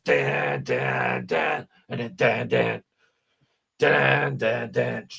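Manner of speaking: vocal guitar riff, punchy; riff imitation. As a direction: sing out the riff beats. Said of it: "crunchy riffs".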